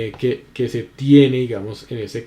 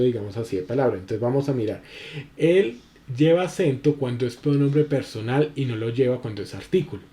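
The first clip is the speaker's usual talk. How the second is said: ordinary talk, nothing marked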